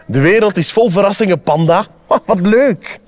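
quick, lightly lifted delight. A narrator's reading. Squirrel to Panda about a world of surprises, delighted. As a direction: marvel cheerfully with a little laugh.

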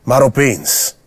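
ordinary delivery, nothing marked

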